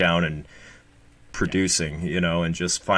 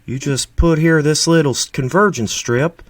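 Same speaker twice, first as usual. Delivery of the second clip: Steve impression; 5 semitones higher, 5 dB louder than usual. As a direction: ham up a Southern YouTuber explaining convergence. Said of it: "that's my Steve".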